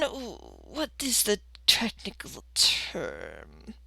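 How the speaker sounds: slow, whispery search for the word; old woman pondering. Acted. Old receptionist hunting for a job term, hushed. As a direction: drop to a slow, hushed mumble.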